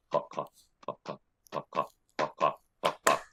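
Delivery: soft, low, steady clops; evenly paced quiet clip-clop in pairs; hoofbeat imitation. Shown as a demonstration. Game master demonstrating a sound from a story, soft steady hoofbeats. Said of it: "it involves this sound".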